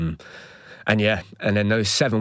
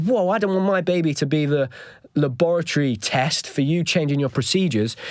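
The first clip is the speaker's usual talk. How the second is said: higher-pitched retold objection; affronted, voice pitched up but not shouting; indignant protest; 6 semitones higher than usual. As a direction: push back in alarmed indignation. Angry father objects to midwives.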